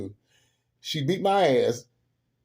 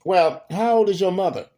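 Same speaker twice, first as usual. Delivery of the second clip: higher, louder interrogation, lightly acted; raised-voice question, falling at the end; Madea questioning; 5 semitones higher, 5 dB louder than usual. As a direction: play Madea quizzing a little girl. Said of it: "when she asked the little girl".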